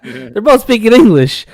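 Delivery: high and loud, laughing through the words; can't stop laughing at the story; laughing hard. Real, not acted.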